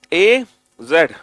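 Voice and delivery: very slow and loud, letter by letter; slow loud recital. Shown as a demonstration. Teacher urging shy students to read loudly, slow. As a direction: call each letter out slowly. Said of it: "बोलो जोर से".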